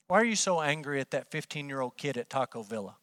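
ordinary delivery

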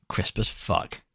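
trailer voice. Pretend. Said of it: "put that on the blurb".

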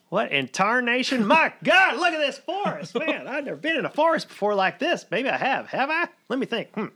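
high, loud grizzled prospector voice; old prospector. Acted. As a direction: yell it like a crusty old gold miner. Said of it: "And he says".

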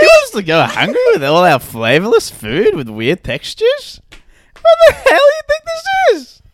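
loud comic tirade climbing high; hammed-up furious diner; mock outrage. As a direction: build to an exploding demand. Playing outraged restaurant customer.

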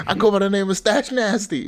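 high-pitched rapper stage intro; mock rapper introduction. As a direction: announce yourself like a hyped-up rapper.

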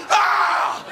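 sudden loud unvoiced yelp; startled yelp. Kid flinching from a fastball, startled yelp. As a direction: flinch and cry out. Ball grazes head.

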